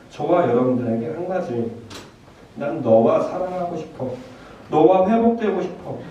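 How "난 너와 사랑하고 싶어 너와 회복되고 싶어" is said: earnest plea rising high and loud; God's earnest appeal. Acted. God appealing to people for a new relationship, earnest and rising. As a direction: plead as God, pitch climbing, deliberate opening. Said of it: "하시는 그 하나님한테".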